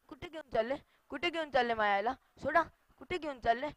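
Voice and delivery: hushed, hurried questioning; small puzzled protest; bewildered child. Acted. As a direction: ask softly in growing alarm. Child sees mother being carried away.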